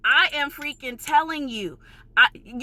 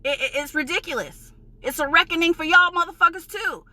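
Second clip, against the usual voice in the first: ordinary talk, nothing marked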